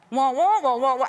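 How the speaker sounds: loud Peanuts grown-up wah wah; muffled wah wah talk. Retold or imitated. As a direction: blare wah wah like cartoon adults. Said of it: "parents and the teachers are saying in a Peanuts movie".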